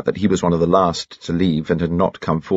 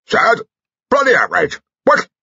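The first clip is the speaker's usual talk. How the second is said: high, loud outraged bluster; indistinct shout; 4 dB louder than usual. A narrator's reading. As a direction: explode like a toothless general. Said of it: "he shouted indistinctly".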